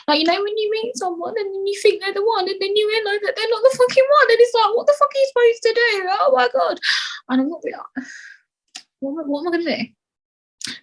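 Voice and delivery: high, mock wailing fake sob; hammed-up whiny crying voice. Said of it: "That was a fake cry".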